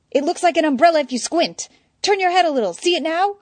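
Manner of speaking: high, eager insisting; insistent. Acted. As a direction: push it, voice up high. Eddie insisting, pitched high.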